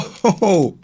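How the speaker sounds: high, loud disbelieving laugh; exasperated laugh. Real, not acted. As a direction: laugh it off in frustration. Laugh after outcry.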